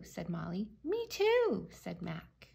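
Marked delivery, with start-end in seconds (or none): 0.8-1.7 very high, eager chiming in; eager child. A narrator's reading. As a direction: pipe up to join in. Child eager to join the fun.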